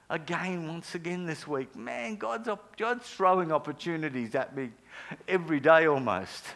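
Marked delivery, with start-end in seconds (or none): 1.7-6.4 excited. Real, not acted. Excited sermon build-up.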